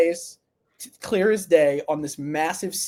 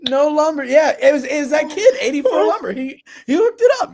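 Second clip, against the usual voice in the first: high, laughing through the words; cracking up as he talks; 10 semitones higher, 4 dB louder than usual. Real, not acted. Host laughing about the kid who hauled lumber, laughing.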